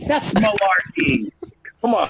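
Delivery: hint of a rambling old politician; Joe Biden impression.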